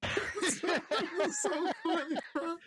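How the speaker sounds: high, drawn-out words through laughter; real laughing. Real, not acted. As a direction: barely get it out while laughing. Laughing over the remembered story.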